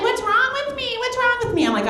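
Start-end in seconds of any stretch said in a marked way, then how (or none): 0.0-0.9 self-pitying whine. Retold or imitated. 1.0-1.6 self-pitying whine. Retold or imitated.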